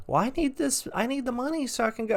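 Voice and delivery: high-pitched mocking plea; pleading homeless man. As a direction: imitate him begging in a squeaky voice.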